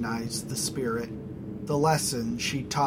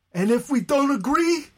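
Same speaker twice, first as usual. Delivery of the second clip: much higher, louder defiant question; defiant objector; 13 semitones higher, 6 dB louder than usual. Acted. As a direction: challenge him defiantly. Basto defiant.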